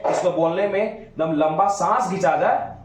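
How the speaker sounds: ordinary talk, nothing marked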